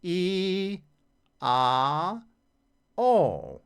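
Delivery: high, loud vowels placed in the face; high resonant vowels. Shown as a demonstration. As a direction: sing the vowels up into the sinuses. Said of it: "make the vowel sounds".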